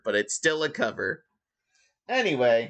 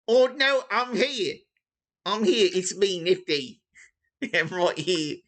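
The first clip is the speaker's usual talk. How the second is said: Cockney orphan; 6 semitones higher than usual. Acted. GM voices late player Nifty as a Cockney orphan. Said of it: "you sound like a Cockney orphan".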